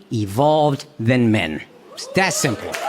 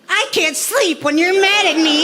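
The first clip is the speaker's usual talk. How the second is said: high mock whimper; pleading like a pitiful boyfriend; hammed-up neediness; needy boyfriend; 11 semitones higher than usual. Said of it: "So I gotta say all that".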